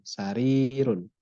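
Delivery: sarirun slowly, the long i held out; long vowel. Shown as a demonstration. Teacher drills the long vowel for students, slow and drawn out. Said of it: "Jadi panjang".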